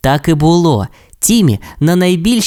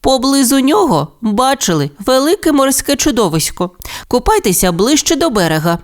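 мама попереджає; 11 semitones higher than usual. Acted. Мама Рекс попереджає дітей.

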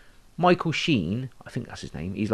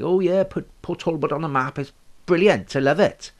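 lightly voiced Welsh locals, a bit higher; a hint of a Port Talbot accent; 5 semitones higher, 4 dB louder than usual. Mimicking Port Talbot people for his mate, light Welsh lilt. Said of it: "whether the way they talk".

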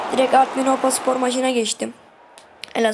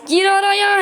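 urging shout; 7 semitones higher, 4 dB louder than usual. Real, not acted. Urging defender in final minutes.

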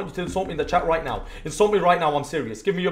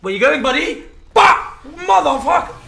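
angry shout; 10 semitones higher, 9 dB louder than usual. Real, not acted. Sudden rage at a game enemy.

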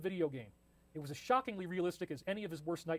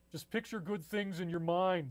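nervous voice; slower than usual. Acted. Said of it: "It sounded nervous".

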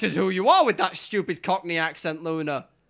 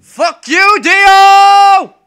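yelling in real fury, very loud and high; furious shout; 12 semitones higher, 12 dB louder, much slower than usual. Real, not acted. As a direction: scream it at the screen. Furious at character closing gate.

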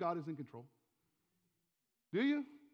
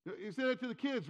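ordinary talk

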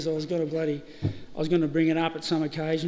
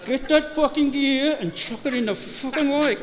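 higher, half-laughing mock rage; angry outburst; 8 semitones higher, 3 dB louder than usual. Derek fed up with tangled reel, mock furious. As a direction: retell the blow-up with a laugh in it.

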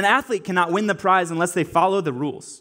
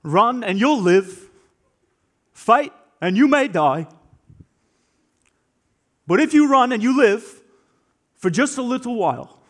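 William Wallace rallying speech; 5 semitones higher, 3 dB louder than usual. Retold or imitated. Wallace rallying nervous Scots before battle, impassioned and loud. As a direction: rouse frightened soldiers to stand and fight. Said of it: "And he says".

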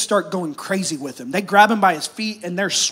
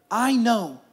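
ordinary talk, nothing marked

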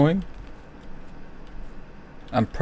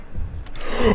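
sharp gasp of shock; frightened gasp; 14 semitones higher than usual. Real, not acted. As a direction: suck in breath in sudden fright. Monster appears in game.

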